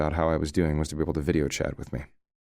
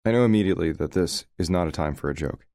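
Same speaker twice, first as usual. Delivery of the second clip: usual speaking voice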